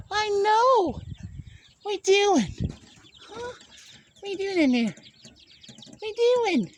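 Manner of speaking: high sing-song pet voice. Real, not acted. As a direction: coo at the little birds. Talking to little birds.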